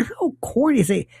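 ordinary delivery

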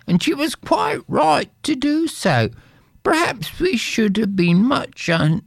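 high, complaining poor wife; wife's voice. Acted. Wife grumbling to her husband about poverty, high voice. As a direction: sigh over being poor.

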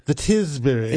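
upper-class snooty. Retold or imitated. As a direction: say it like a posh snob. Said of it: "a snooty sort of voice".

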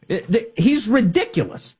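ordinary talk, nothing marked

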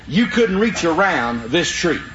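usual speaking voice

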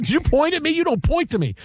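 high, indignant wife; mock scolding wife. Husband retells his wife telling him off. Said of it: "She goes".

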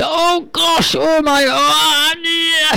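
high, frantic yelps of panic; panicked scream. Acted. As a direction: squeal it like a man in sudden pain.

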